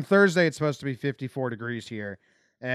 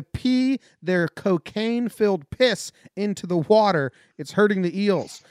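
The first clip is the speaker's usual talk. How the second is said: news read; 4 semitones higher, 4 dB louder than usual. Acted. Host reading strange headlines to his co-host, deliberate newsreader delivery. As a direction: read out odd headlines with measured emphasis.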